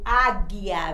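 loud, low "águia", hard G and silent U; careful pronunciation demo. Shown as a demonstration. Teacher showing pupils a GU digraph, emphatic "águia" with silent U. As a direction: say "águia" firmly, with no U sound after G. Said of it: "a gente não pronuncia".

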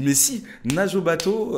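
loud high urgent insistence; panicked insisting. Retold or imitated. Insisting to his ex about the shark, loud and frantic. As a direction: push her to swim now, frantic.

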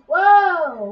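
loud startled whoa; surprised shout. Real, not acted. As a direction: jump back, shout it out. Sudden surprise in game.

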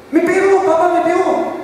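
put-on high, pleading little kid's voice; whiny child. Small son complaining to his father in a high whiny voice. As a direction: play the small son tattling to dad.

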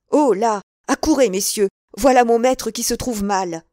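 loud alarmed call to the others; panicked servant yelling for the masters; calling for help. A narrator's reading. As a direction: shout across the inn yard for help.

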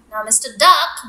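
usual speaking voice

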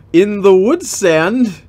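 baffled, drag the words out; incredulous. Real, not acted. Reader baffled by the fan fiction text, slow and loud.

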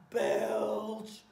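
loud, drawn-out word like a burp; mock belch. Train in a burping contest, loud mock belch. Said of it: "Or having a burping contest".

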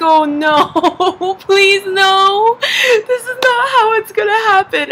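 high, loud mock pleading; whiny, panicked protest, played for laughs; retold dread. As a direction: plead in hammed-up horror. Dreading dad's announcement at dinner.

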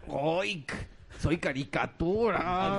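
high, slow cartoonish dubbing voice. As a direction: ham it up like a cartoon. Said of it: "están como muy".

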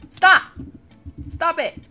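sharp high yell; shout. Real, not acted. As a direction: bark at them to stop. Teammate stealing items.

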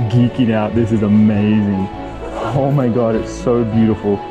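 normal talking voice

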